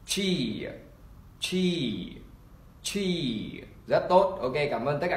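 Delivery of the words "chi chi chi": "chi" with a long, falling ee; model pronunciation. Shown as a demonstration. Teacher models the last word, drawn-out vowel. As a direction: stretch each "chi", pausing for repetition. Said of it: "chúng ta đọc là".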